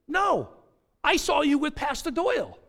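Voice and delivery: high, loud acted contradiction; emphatic mock accusation; insistent accuser.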